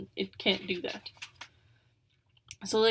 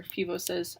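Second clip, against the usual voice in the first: ordinary delivery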